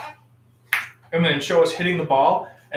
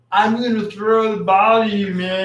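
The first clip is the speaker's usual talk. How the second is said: dude voice; 5 semitones higher, 5 dB louder than usual. Pretend. Acting out a silly sports bit.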